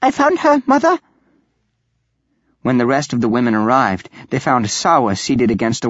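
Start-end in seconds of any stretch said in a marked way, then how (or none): 0.0-1.0 put-on high-pitched young daughter's call. A narrator's reading. Daughter to mother on finding the missing aunt, calling out. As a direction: call to your mother in a much higher voice. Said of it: "she called".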